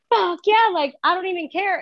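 high-pitched careless cheer; retold bravado. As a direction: toss it out high, cocky and carefree. Retold bravado while driving into ditch.

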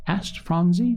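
ordinary delivery, nothing marked

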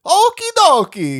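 high loud slow Mario okie dokie. Retold or imitated. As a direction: squeal it like Mario. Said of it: "he's got that".

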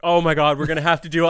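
mock panic. Mimics friend panicking over wine leak.

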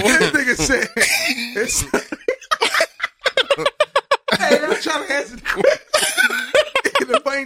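words broken up by laughter; can barely get it out for laughing. Real, not acted. Cracking up mid-answer.

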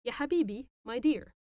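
ordinary delivery

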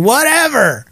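high, drawn-out teasing dismissal; mock dismissive. Real, not acted. Teasing Jen who is listening, loudly dismissive. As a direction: call it out pointedly, pitch swooping up.

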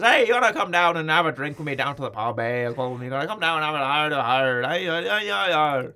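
hammed-up Irish babble; bad Irish accent dissolving into gibberish; horrible Irish accent. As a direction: do a silly, sing-song Irish caricature. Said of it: "I do apologise for a horrible accent there".

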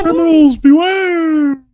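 high, slow dopey hero cry; hammy doofus superhero call; dumb guy voice. Pretend. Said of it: "a dumb guy voice".

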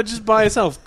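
ordinary talk, nothing marked

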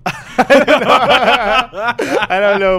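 real helpless laughter; laughing. Real, not acted. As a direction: crack up so hard the words stretch out. Laughing, unsure if story's allowed.

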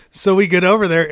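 normal talking voice

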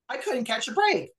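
high, sighing exasperation; real weary disbelief; exasperated sigh. Real, not acted. Sighing over bad luck.

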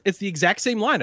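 usual speaking voice

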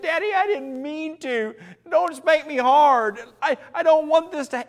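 put-on high, whiny begging kid voice; pleading child. Child begging daddy for mercy, high and pleading. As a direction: beg daddy not to spank hard. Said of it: "this simple statement".

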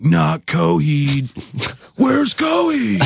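high, drawn-out heckler's yell. Retold or imitated. Band member imitating a kid as the show starts, mock yelling. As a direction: imitate a kid yelling from the crowd, stretching every word. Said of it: "some kid yells".

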